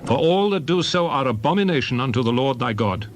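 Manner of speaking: ordinary talk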